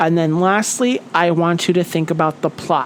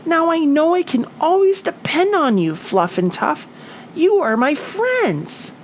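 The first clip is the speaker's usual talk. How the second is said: Little Flap, grateful; 9 semitones higher than usual. A narrator's reading. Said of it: "Little Flap said".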